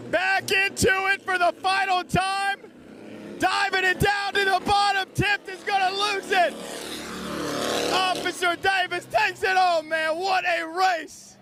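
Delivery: high, driving play-by-play, shouting over the engines; live race call. Acted. Announcer calling the race to the crowd, shouting the play-by-play. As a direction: call the race to the finish line.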